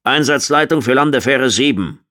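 calling out. A narrator's reading. Officer calling mission control from the shuttle, calling out. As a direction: call command over the radio. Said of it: "dann rief Stannis".

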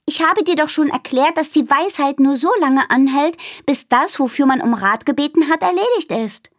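exasperated, spelling it out again; annoyed. Acted. Minnie fed up with Aru's question, annoyed. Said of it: "Minnie war hörbar genervt".

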